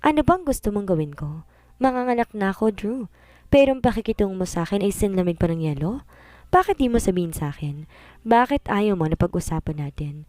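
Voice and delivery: ordinary delivery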